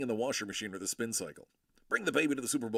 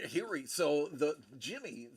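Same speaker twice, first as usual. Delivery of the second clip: ordinary delivery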